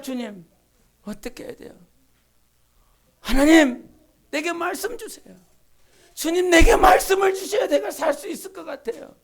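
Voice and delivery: 작게 시작해 외치듯 커지는 간절한 기도; 속삭임에서 울부짖음으로 매달려; desperate prayer. Retold or imitated. 절박한 기도를 재연. (작은 속삭임에서 큰 외침으로 커지는 간절한 기도)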